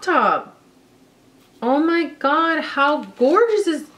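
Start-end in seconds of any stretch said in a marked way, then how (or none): 1.6-3.9 delighted gush. Real, not acted. Admiring the crop top.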